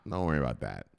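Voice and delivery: ordinary delivery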